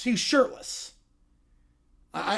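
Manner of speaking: ordinary talk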